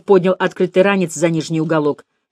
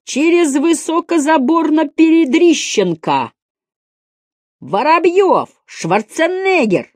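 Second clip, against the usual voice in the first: high-pitched mocking classroom calls; drawn-out, high schoolkid heckling; pupils shouting; 11 semitones higher, 3 dB louder, slower than usual. A narrator's reading. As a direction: yell out names from the desks, high. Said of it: "закричали Слушкину".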